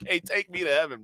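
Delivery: usual speaking voice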